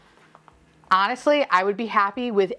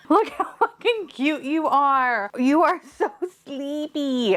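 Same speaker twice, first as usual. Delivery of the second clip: cooing; 5 semitones higher, slower than usual. Real, not acted. Talking to a cute sleepy figurine, cooing. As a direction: draw it out adoringly, pitched up. Gushing over a cute toy.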